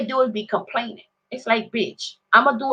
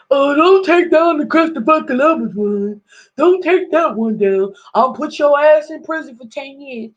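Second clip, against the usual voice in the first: higher, louder mock Trump voice; imitating Trump; 6 semitones higher, 4 dB louder than usual. Mocking Trump defending Columbus statue, high loud voice. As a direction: ham up Trump's threat, pitched up. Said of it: "He like".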